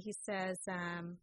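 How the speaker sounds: ordinary talk, nothing marked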